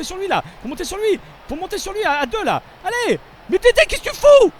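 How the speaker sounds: high loud urgent commands building to a furious yell; frustrated yelling. Real, not acted. Gamer yelling at teammate Dédé who won't double, furious shout. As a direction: bark orders then blow up at Dédé.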